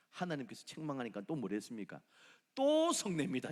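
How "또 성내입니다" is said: high, loud burst of disbelief; exclaiming. Real, not acted. Preacher marvels at Jonah to the congregation, exclaiming high. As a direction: throw it out, amazed.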